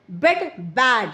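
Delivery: बेड with a narrow mouth, बैड with the mouth opened wide; bed versus bad. Shown as a demonstration. Teacher contrasting बेड and बैड for learners, mouth width. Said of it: "बेड में कम मुंह खुलता".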